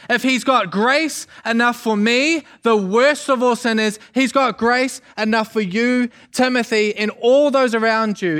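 put-on high, impassioned preaching to a young protégé; fired-up encouragement. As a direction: build him up with rousing conviction. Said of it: "Did that get you fired up?".

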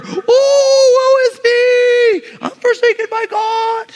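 high, wailing melodrama; hammy stage actor. Acted. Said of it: "That was a good voice".

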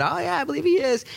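high, eager yes; mock eager believer.

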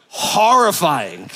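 "Horrifying" stretched very slow and deliberate; drawn-out horrifying. Real, not acted. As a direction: drag out "Horrifying" for comic weight.

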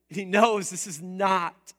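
usual speaking voice

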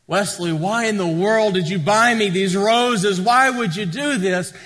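high, loud gush of surprise, acted out; delighted wife. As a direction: play a wife thrilled by the roses. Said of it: "her face just lights up, and, and she says".

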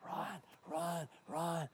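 soft calling voice. Acted. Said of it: "This voice is still going".

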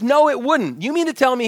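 fired-up friend arguing. Retold or imitated. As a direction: push back with fire. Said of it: "He was, like, fired up".

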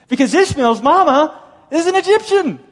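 very high, loud exclamation; emphatic objection. Acted. Fictional objector telling Paul about Ishmael's mother, emphatic cry. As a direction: pitch it way up, hammering the point. Objector's argument, voiced mockingly.

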